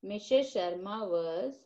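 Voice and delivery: slow, drawn-out dictation with a rising end. Shown as a demonstration. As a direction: spell the answer out slowly. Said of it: "not audible".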